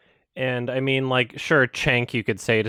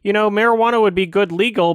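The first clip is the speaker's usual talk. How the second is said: high, louder put-on voice for Trump musing; raised, louder caricature of a dithering Trump; mock Trump; 10 semitones higher, 4 dB louder than usual. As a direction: ham up a dithering president. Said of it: "Trump is like".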